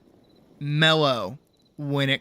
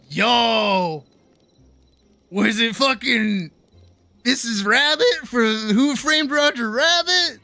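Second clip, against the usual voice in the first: excited yo; 5 semitones higher, slower than usual. Pretend. Teasing daughter excitedly.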